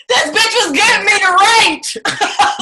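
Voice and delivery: high and loud, laughing through it. Real, not acted. Telling the audience how the therapist tricked her, laughing. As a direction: crack up at the memory.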